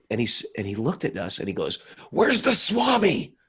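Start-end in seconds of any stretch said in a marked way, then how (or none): 2.1-3.3 much higher, louder and slow, a startled stranger; retelling the garbage man, high and drawn out; homeless man's voice. Retold or imitated.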